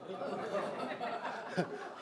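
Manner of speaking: soft, airy chuckle; embarrassed little laugh; quiet laugh. Real, not acted. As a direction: laugh under your breath at the absurdity. Laughing at being made president.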